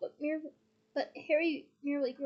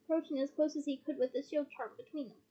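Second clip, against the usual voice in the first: ordinary delivery, nothing marked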